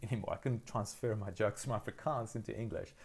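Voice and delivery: normal talking voice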